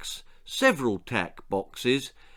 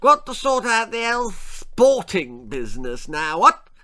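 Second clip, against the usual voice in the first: high, loud upper-class bluster with barking "What?"; pompous toff sizing up the natives; hunting lord introducing himself; blustering posh lord; 9 semitones higher, 5 dB louder than usual. A narrator's reading. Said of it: "continued Lord Percy".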